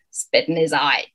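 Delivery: lightly voiced low toad; croaking toad. Toads sizing up the gentleman, lightly croaked. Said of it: "croaked the toads".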